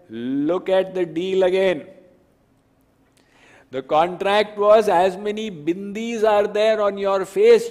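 high, loud gleeful teasing; triumphant gopi. Acted. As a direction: gloat over the empty account book. Gopi reading contract to Krishna.

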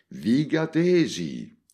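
high, loud, slow call to the crowd; shouted call. Acted. Montalbano calls out to the whole circus over the speakers. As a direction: boom it over the loudspeakers. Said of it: "gridò Montalbano".

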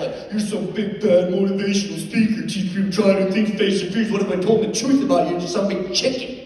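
put-on high, loud kid jeering; acted child's heckle; mocking taunt. Imagined daughter taunts her dad.

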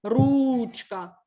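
Ручка with a long, loud У; clear stressed vowel. Shown as a demonstration. Teacher gives pupils an example of stress, drawing out the У in Ручка. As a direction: punch and hold the У in Ручка. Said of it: "тому що падає наголос".

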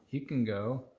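ordinary delivery

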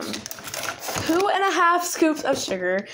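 slow and drawn out; slow, stretched-out drawl. Real, not acted. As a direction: stretch the words lazily. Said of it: "I don't know why I'm talking like this".

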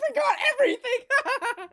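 high, loud laughing shout; laughing squeal. Real, not acted.

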